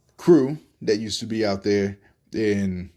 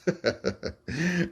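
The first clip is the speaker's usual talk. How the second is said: amused chuckle; 7 semitones higher, 6 dB quieter than usual. Retold or imitated. Doctor laughs at drunk sailor.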